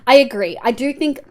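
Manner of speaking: ordinary talk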